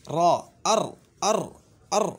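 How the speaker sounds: अर with a stressed, held r; articulation demo. Shown as a demonstration. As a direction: repeat अर to find the r. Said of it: "मखरज मालूम करने के लिए उसके शुरू में हमज़ा ज़बर ला".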